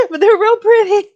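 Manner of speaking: high excited gush; delighted over cute stickers. Real, not acted. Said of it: "Look, pretty stickers".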